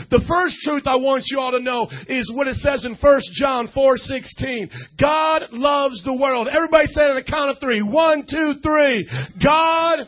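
loud, emphatic preacher voice calling to the congregation; preaching at full force. Acted. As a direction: preach it out to the whole crowd.

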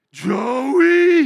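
huge drawn-out holler of a name; father-in-law's bellow. Retold or imitated. Father-in-law arriving and calling for him, bellowing. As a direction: holler the name at full volume, stretched. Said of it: "I do an imitation of my father-in-law".

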